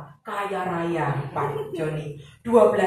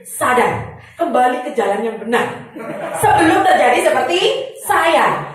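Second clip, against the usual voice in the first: loud exhortation; 3 semitones higher, 7 dB louder than usual. Real, not acted. Urging audience to repent.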